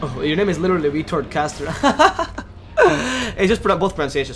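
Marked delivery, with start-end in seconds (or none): 1.8-3.3 sudden high burst of laughter; real high-pitched laugh. Real, not acted. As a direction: crack up at a silly name. Laughs at a viewer's name.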